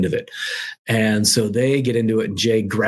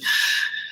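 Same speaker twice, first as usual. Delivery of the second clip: a long, unvoiced sigh before bad news; reluctant sigh before delivering bad news; weary sigh; 4 dB quieter than usual. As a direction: breathe out heavily, reluctant to say it. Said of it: "He's like".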